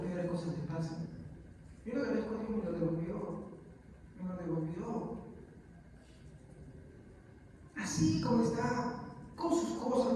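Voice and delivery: a father telling the church about his son, hushed; hushed testimony. Real, not acted. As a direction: speak low as if reliving it.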